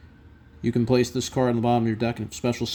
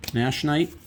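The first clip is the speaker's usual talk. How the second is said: ordinary talk, nothing marked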